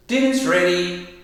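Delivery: high, loud call of dinner's ready; mum calling dinner. Shown as a demonstration. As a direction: sing it out like mum calling the family. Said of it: "when your mum says".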